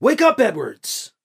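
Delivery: high, slow call to wake him; urgent yell at the dazed man. A narrator's reading. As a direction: shout his name to rouse him. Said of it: "as he also yelled".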